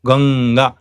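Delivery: Ganga slow, with held ng; Ganga, back nasal before ga; velar anuswara. Shown as a demonstration. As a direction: draw out the ng in Ganga. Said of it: "So Anuswara is pronounced as this".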